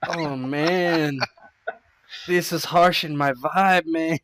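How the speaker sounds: high, loud in-character complaint; dismayed character voice. Acted. Player's character facing a flood of Gimps, high dismayed complaint.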